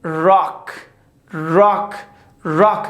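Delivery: راک with round English R, no flap; English R in rock. Shown as a demonstration. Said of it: "در این لغت ما نمیگیم راک". (راک با آر گرد انگلیسی)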